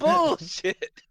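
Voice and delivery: high squeaky scoff of disbelief. Acted. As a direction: scoff at it like it's nonsense. Mocking disbelief at story.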